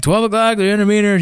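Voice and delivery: calling out. Retold or imitated. Soldier calling distance and direction to the patrol, calling out. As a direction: call out a bearing in mock drill.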